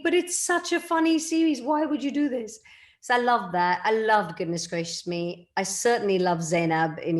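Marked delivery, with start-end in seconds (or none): 0.0-2.6 higher-pitched, mildly upset recalled complaint; retold dismay, lightly pleading; upset protest. Actress recalling her series being axed, upset protest. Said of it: "I remember being so upset thinking".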